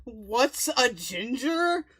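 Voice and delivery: drawn-out incredulous echo; slow mocking repeat. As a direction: repeat it slowly in disbelief. Repeating Fallon's question slowly.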